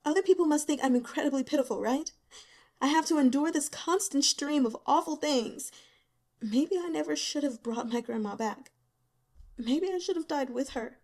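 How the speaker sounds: lightly voiced young woman, bitter and self-pitying; a hint of a worn-out student, bitter. Young woman unloading her misery on the investigators, bitter.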